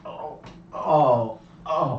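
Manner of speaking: hammed-up pained groan; mock groaning. Man faking chili sickness in the truck, mock groaning. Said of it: "I suddenly started going".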